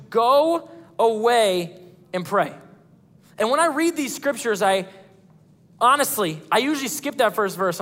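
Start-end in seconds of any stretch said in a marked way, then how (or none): none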